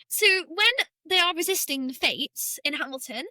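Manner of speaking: ordinary delivery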